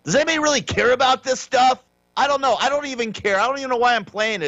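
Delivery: exasperated, voice shooting up high. Real, not acted. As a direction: pitch jumps high, fed up and dismissive. Exasperated rant about viral clip.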